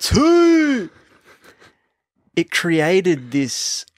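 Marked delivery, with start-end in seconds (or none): 0.0-0.9 loud, high, long whoop; imitated yell. Imitating the racer yelling while passing, loud high whoop. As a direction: belt it out high like a horn. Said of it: "and you yelled out".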